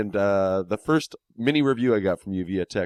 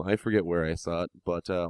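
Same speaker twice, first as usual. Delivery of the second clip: normal talking voice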